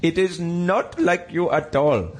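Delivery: high, slow put-on Indian accent. New Delhi people fault his Scottish accent, slow high Indian accent. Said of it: "people are like".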